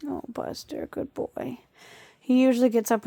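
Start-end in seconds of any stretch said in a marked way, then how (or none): 0.1-1.6 whispered. Real, not acted. Soothing dog during migraine.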